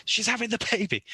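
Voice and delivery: high-pitched frantic explanation; mock-frantic father rushing past; retold panic. Frantic father stopped in the corridor.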